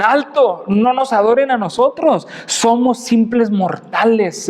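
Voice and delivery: high, emphatic retold plea to stop; acted urgent protest. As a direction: halt the crowd as the apostles would, slowing at the end. Apóstoles frenan a la multitud. (protesta apremiante, actuada)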